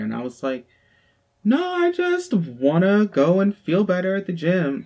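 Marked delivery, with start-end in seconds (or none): 1.4-4.8 put-on higher, louder, slowed-down snap back at the boyfriend; irritated retort. To her jealous boyfriend about the gym, an irritated slow snap. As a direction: bite each word out, fed up. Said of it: "what are you mad for?".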